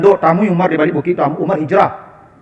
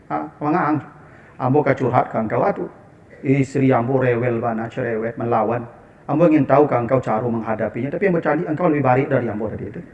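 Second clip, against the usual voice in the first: low, subdued voice of an imitated troubled husband; confiding complaint; 6 semitones lower, 7 dB quieter than usual. Companion confides to Umar about his nagging wife, subdued complaint. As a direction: act out a soft, low-pitched confession of marital trouble. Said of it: "sahabat kan mengadu ke Umar".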